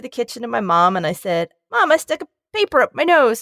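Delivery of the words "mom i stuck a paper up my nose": put-on higher, small kid's confession to mom; little kid confessing. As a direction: own child self owning up, a bit higher. Voices herself as little kid.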